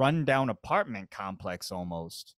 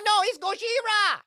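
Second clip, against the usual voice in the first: high, loud panicked scream; monster movie panic; 4 dB louder than usual. Retold or imitated. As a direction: scream like a fleeing extra. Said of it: "It's like".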